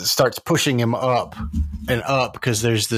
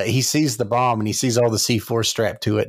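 ordinary delivery

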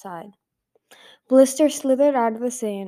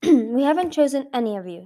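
higher, louder firm statement; 5 semitones higher, 6 dB louder than usual. A narrator's reading. Sunny correcting the three rival sisters, firm. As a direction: put your foot down. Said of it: "Sunny said firmly".

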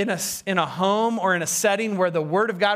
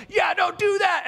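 high, raised mock scold; scolding shout; 12 semitones higher than usual. Hypocrite telling others off, mock scolding shout. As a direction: call it out sharply, pitch way up.

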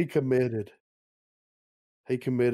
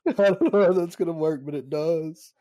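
higher, louder, fast through laughter; laughing; 7 semitones higher, 5 dB louder than usual. Retold or imitated. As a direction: quote it while cracking up. Quotes movie line while laughing.

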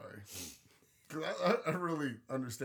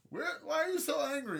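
high-pitched imitation of women; mocking women's voice; 13 semitones higher, 4 dB louder than usual. Man imitating women asking about bottled-up anger, high mocking voice. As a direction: put on a high, questioning female voice. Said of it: "fucking women are like".

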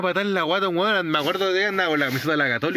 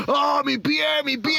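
high mock wail of pain; imitated pained yelling; 7 semitones higher, slower than usual. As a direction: mimic an injured man crying out. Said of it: "estaba ahí gritando afuera". (grito de dolor imitado, agudo)

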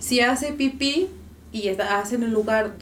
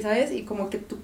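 ordinary talk, nothing marked

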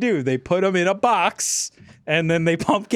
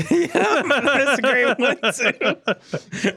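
high voice breaking into laughter; laughing; 10 semitones higher than usual. Real, not acted. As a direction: tell it while cracking up. Cracking up retelling a scene.

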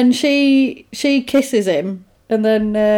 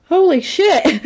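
mock shock; 8 semitones higher, slower than usual. Reacting to head exploding.

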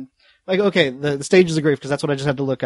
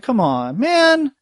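pleading protest; 8 semitones higher, much slower than usual. Acted. Imagined pleading of the film character.

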